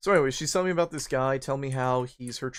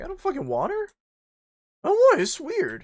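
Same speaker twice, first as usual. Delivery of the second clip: much higher, hammed-up indignant squeal; mock shrill protest; 6 dB louder than usual. Refusing the girl he's offered, a much higher mock shriek. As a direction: shriek it in disgusted denial.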